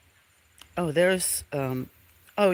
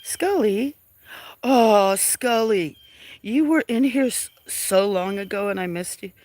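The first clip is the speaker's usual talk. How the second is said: delighted greeting; 6 semitones higher, 4 dB louder than usual. Real, not acted. Delighted recognition of viewer.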